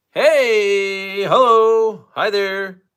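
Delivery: high, loud delighted hello; excited greeting. Real, not acted. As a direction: greet an arriving friend brightly. Greeting a viewer who arrives.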